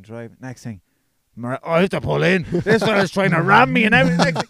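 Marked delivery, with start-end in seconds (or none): 1.3-4.4 loud, high retold phone outburst; mock alarmed shout. Mate being rammed phones his friend, mock alarm. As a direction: build to a hammed-up frantic yell. Acting panicked mate under attack.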